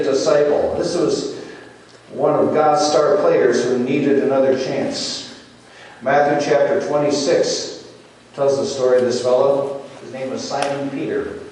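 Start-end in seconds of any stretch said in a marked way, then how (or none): none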